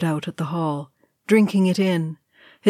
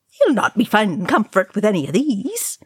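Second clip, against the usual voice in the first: higher, swooping indignant comeback; retort; 4 semitones higher than usual. A narrator's reading. Oin answering Ori, indignant retort. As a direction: fire back with pitch jumping about. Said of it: "Oin retorted".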